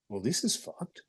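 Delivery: soft, deadpan understatement; underplay it drily, like an unimpressed Aussie; laconic dry underplay. Retold or imitated. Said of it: "I just love that laconic, dry underplay".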